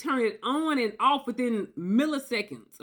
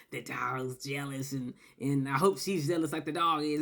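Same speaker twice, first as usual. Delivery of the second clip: put-on gloating boyfriend; 7 semitones lower, 5 dB quieter than usual. Boyfriend gloating as the dog sits beside him. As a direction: quietly relish her jealousy.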